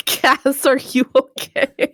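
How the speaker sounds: asking through laughter; laughing. Real, not acted. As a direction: giggle the question. GM laughing at player.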